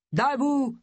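high, forceful order; barked command to captives. A narrator's reading. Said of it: "ordonna Charlie".

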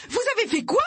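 very high, outraged yell; piercing, incredulous scream; piercing shriek. A narrator's reading. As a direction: shriek it in disbelief. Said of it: "hurla Pénélope d'une voix perçante".

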